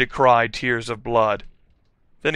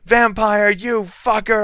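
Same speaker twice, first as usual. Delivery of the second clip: high, indignant outburst; angry shout; 9 semitones higher, 5 dB louder than usual. A narrator's reading. Girl slapping Vampire, high angry shout. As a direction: raise the pitch and snap it. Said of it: "I shouted".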